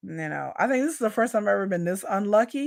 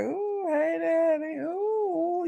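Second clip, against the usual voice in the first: higher, softer, swooning coo; flirty coo; 10 semitones higher, 4 dB quieter, much slower than usual. Pretend. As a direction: melt at a lover's sweet talk, cooing. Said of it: "I'll be like".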